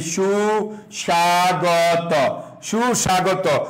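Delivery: সুস্বাগত drawn out slowly syllable by syllable; slow spelled out. Shown as a demonstration. Teacher to class, slowly drawing out সুস্বাগত. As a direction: stretch সুস্বাগত slowly so students catch each part.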